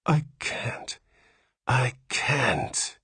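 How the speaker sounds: a breathy half-whispered moan. Acted. Father to nurse, moaning in despair. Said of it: "he moaned".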